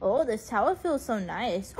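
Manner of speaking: ordinary talk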